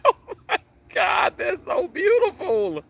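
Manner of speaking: high, loud, drawn-out happy tears; overcome, crying. Real, not acted. Vlogger sees mom's sign in a cardinal, tearful wail. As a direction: wail in joy through tears and laughter. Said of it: "I'm sitting here crying".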